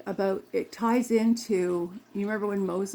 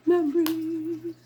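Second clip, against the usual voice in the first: high, slow, put-on wistful voice; mock dreamy memories; 10 semitones higher, 4 dB louder, much slower than usual. Playfully pretending to be someone else, a high drawn-out sigh. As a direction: stretch it out like a playacted character. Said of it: "I can't pretend to be her".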